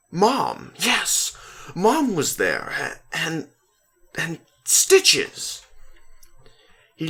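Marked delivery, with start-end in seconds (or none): 0.1-5.6 high, louder eager recall; suddenly remembering with joy; bursting out as it comes back; excited remembering. A narrator's reading.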